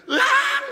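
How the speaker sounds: very high, laughing mock shriek; hammed-up scream of a little girl at play; a playful fake cry of fright; overacted scream. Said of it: "오버하면서 반응하는 거예요".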